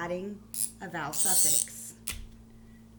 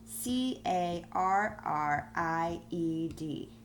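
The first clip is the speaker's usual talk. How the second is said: letters spelled out slowly; careful slow spelling for children; much slower than usual. Shown as a demonstration. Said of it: "I wanna make it carried".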